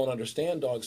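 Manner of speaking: normal talking voice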